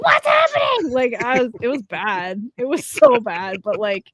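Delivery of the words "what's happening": high, loud startled shriek; retold panicked cry. Gamer as the light came on, mock-panicked high cry. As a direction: cry out as if caught mid-sob. Retelling frantic scene with husband.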